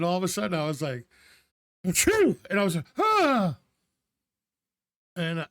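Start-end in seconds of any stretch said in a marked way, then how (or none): 1.8-2.4 a loud, high mock sneeze; big sneeze. Retold sneeze in Zoom class. 3.0-3.6 high, loud yelp of pain, replayed; cry of pain. Back gives out after sneeze.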